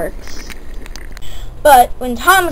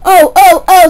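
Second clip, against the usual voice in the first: high loud scream of a freight car; 5 semitones higher, 9 dB louder than usual. Acted. Freight car screaming as Thomas pulls it. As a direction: shriek it in alarm. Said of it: "screamed the freight car".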